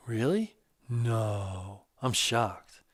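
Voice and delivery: teasing, affectionate sarcasm; gently mock his surprise; sarcastic. A narrator's reading. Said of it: "he said in a lovingly sarcastic way".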